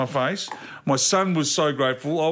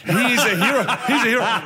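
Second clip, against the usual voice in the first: high, loud, laughing proclamation; delighted acclaim; 7 semitones higher, 4 dB louder than usual. Real, not acted. Storyteller hailing the bloke who offered to help, delighted. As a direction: proclaim it emphatically over the laughter. Praising the helpful stranger amid laughter.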